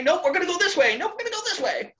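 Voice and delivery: very high cartoonish back-and-forth; mock flip-flopping. Work schedule changing direction, high mock voice. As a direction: squeak out each abrupt change of plan.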